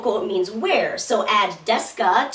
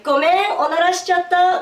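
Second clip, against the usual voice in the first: higher, louder, playfully cutesy confession; cute and blatant; 8 semitones higher, 4 dB louder than usual. Pretend. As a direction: chirp it out like a cheeky little admission. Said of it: "cute and blatant about it".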